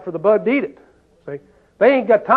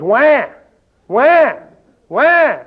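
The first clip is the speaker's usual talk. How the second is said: put-on wailing wah wah like a baby; mock baby wail; mock baby crying; 5 semitones higher, 3 dB louder, much slower than usual. Shown as a demonstration. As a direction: imitate a whiny crying baby, rising. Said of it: "That's what babies do".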